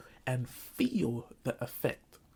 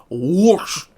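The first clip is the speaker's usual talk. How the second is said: mock whoosh; 3 semitones higher, 11 dB louder than usual. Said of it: "I was like".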